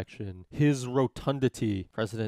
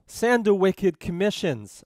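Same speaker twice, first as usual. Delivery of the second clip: put-on loud, slow, higher Boston accent; mock Boston accent; 6 semitones higher, 7 dB louder, slower than usual. Adams ordering Marshall about commissions, in mock Boston accent. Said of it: "Marshall's not even from Boston".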